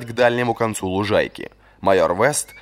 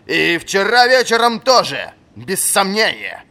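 high, slow stern certainty; slow accusation; 11 semitones higher, 5 dB louder, slower than usual. A narrator's reading. Old master accusing the captain of drinking. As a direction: drive the accusation home.

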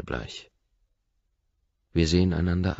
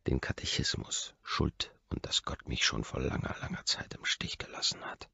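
whisper; 7 dB quieter than usual. A narrator's reading. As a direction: breathe it out like a secret. Bitter confession about God.

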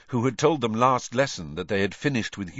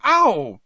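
pained cry; 12 semitones higher, 10 dB louder than usual. A narrator's reading. Boils erupt on her hands.

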